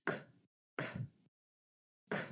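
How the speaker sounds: "k" released with a puff of air; breathy aspirated "k", said alone; regular K. Shown as a demonstration. Teacher models the regular sound first, airy K demo. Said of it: "let's make a regular K sound".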